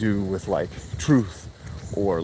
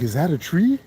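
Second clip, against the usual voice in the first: put-on lightly raised baby voice asking about a tree; a hint of a toddler's curious question; baby's question; 7 semitones higher than usual. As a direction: mimic a small child asking, a bit higher. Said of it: "babies just mimic everything".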